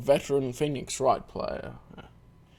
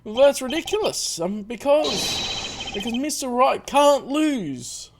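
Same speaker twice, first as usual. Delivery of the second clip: loud, raised-pitch courtroom protest; protesting lawyer; 10 semitones higher, 5 dB louder, slower than usual. Acted. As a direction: object indignantly, then stall on the reason.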